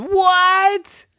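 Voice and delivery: very high, startled squeak; startled what. Acted. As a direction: jump in surprise. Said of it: "And Monty said".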